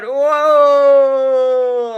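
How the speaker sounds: usual speaking voice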